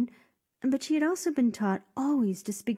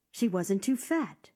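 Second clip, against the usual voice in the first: plain reading voice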